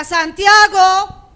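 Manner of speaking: long, booming call of the name; loud, hard call. Acted. Mother calling her eldest son at home, loud hard call. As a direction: holler for your son, stretching the name out. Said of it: "yo tengo un timbre de voz fuerte y duro, alto".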